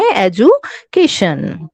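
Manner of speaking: Education slowly, split into pieces; teacher slowly sounding out education in parts; broken into syllables. Shown as a demonstration. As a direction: break education up as e-ju, kay-shun. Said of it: "तीन टुकड़ों में आप कर सकते हैं".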